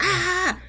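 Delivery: short high mock yell; imitated scream. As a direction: squeal out a quick imitation of his scream. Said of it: "you hear him screaming like".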